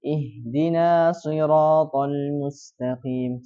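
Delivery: Ihdina siratal mustaqim slow, with a single s; slow recitation missing the shadda; tasydid dropped. Shown as a demonstration. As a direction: demonstrate the mistake without the doubled s. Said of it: "tapi dia hilangkan tasydidnya".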